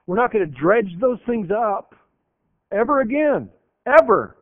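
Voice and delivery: rising to a loud, high final "Ever"; emphatic promise. Acted. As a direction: hammer home that it's gone for good. God's voice, emphatic climax.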